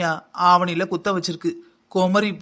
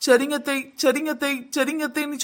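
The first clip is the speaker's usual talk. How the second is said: higher, meek mock obedience; parroting a dutiful daughter-in-law; meek yes-auntie; 7 semitones higher than usual. Daughter-in-law mocking her endless yes to mother-in-law, meek and higher. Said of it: "சொல்லி சொல்லியே".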